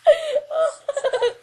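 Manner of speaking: laughing while yelling. Real, not acted. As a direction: laugh through the insult. Laughing at friend.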